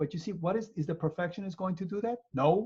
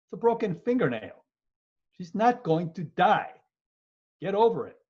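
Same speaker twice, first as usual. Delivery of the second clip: louder, exasperated scolding; 4 semitones higher, 5 dB louder than usual. Acted. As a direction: tell her off, fed up. Scolding the fingernail patient.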